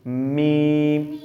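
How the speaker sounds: میم drawn very long on one note; slow, sustained teaching میم; letter demonstration. Shown as a demonstration. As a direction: hold the vowel of میم steadily, then close the lips. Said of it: "تو میم نکلے گا".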